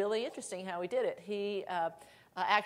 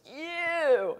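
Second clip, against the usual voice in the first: mock accusing shout; 9 semitones higher, 7 dB louder than usual.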